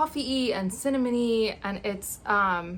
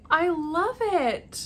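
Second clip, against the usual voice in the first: higher, louder, drawn out slowly; delighted gush; 7 semitones higher, 7 dB louder, much slower than usual. Real, not acted. Unwrapping an ornament from an actress, delighted gush. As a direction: gush over the gift.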